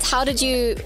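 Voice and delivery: normal talking voice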